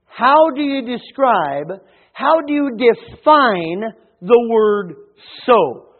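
slow, emphatic pulpit delivery with big pauses; measured, building sermon proclamation; forceful preaching. Real, not acted. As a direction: preach it out, leaning hard on key words. Preaches on the word so.

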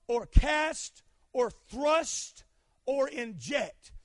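"cast", "thrust", "inject" stretched out slowly; slow emphatic list. Shown as a demonstration. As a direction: spell each word out slowly. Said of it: "carries the meaning".

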